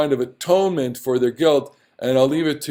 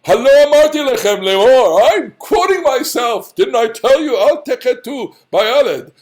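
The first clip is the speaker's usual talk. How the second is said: high, loud self-important declaiming; hammed-up grand oratory; lofty pomposity; 7 semitones higher, 6 dB louder than usual. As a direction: proclaim it as if quoting scripture of your own. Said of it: "here he's using very lofty language".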